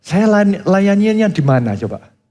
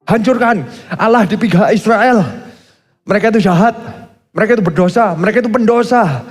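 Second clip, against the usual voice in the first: raised, hammed-up war cry of a zealous prophet; mock warmonger prophet; 5 semitones higher than usual. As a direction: rant like a prophet itching for war. Preacher voicing warmongering prophet.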